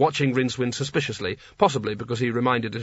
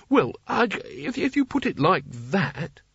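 flustered Rincewind; 7 semitones higher than usual. A narrator's reading.

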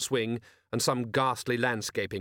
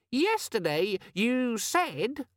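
very high, slow, squeaky indignant voice; aggrieved little gadget; angry demon; 13 semitones higher, much slower than usual. A narrator's reading. Organizer demon complaining to Vimes, squeaky and angry. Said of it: "gave him an angry look".

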